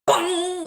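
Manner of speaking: loud, high bong; imitated bong. Game master sounding the net springing back, imitated bong. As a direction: voice a cartoon net recoil.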